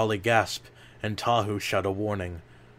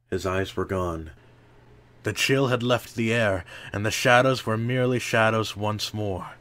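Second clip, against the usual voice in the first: plain reading voice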